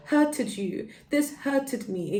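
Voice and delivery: put-on little kid saying hurted you; high, slow child voice; childlike voice. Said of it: "I'm talking like a child".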